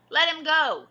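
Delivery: high, loud order to the pirate; loud demand. A narrator's reading. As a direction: shout it up high at him. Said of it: "she said".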